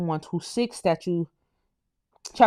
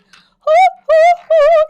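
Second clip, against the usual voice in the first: three very high, loud hoos; high whooping hoots; 12 dB louder, much slower than usual. Real, not acted. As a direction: let out loud falsetto whoops. Whoops after singing, overcome.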